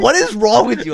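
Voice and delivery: loud, high retold disbelief; exasperated. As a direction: imitate a friend at wits' end. Retelling friend's exasperated repeated question.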